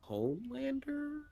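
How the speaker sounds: high, slow, soft questioning rise; unsure guess. Real, not acted. Friend guessing who wins the three-way fight, high and unsure. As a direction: offer the name tentatively, sliding way up.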